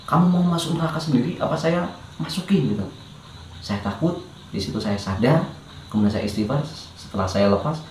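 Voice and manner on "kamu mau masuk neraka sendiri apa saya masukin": higher threatening voice; mild retold threatening voice. Voices in his head threaten him, higher menacing voice. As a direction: echo the menacing voice. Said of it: "teriakan itu".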